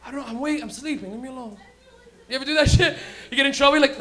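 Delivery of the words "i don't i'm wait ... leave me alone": mock sleepy mumbled protest; pretending to wake. Son to mom through the door, pretending to wake up. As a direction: play half-asleep, softly fending off a parent. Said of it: "I pretended like I was waking up".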